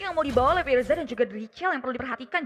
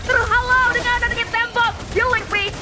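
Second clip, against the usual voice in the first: esports caster; 5 semitones higher than usual. Acted. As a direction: build the call to a shout. Live play-by-play of Lord fight.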